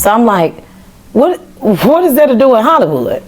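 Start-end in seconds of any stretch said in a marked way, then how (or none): 1.1-3.2 much higher, puzzled; baffled, ask why Hollywood; confused surprise. Retold or imitated. Told she's going to Hollywood, baffled.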